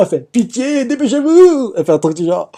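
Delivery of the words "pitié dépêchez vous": much higher, strained imitated begging; the suffering voice pleads with Luffy, mock anguish; pained plea. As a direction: beg for help as if in pain, pitched up. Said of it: "écrit en souffrant".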